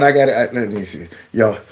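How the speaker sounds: ordinary delivery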